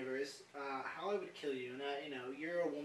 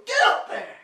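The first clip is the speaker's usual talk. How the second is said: high, loud mock command; hammed-up shout; shouted order; 19 dB louder than usual. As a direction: yell her up onto the stage. Acting out ordering her onto stage.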